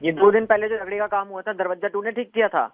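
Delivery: the fed-up newlywed, pitched a little down; lightly voiced young groom.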